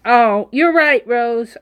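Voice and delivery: usual speaking voice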